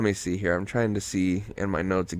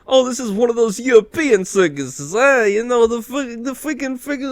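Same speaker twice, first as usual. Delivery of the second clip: much higher, goofy put-on voice; hammed-up movie character; 12 semitones higher than usual. Imitating Mike inside the circus tent, hammed-up high babble. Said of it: "He's like".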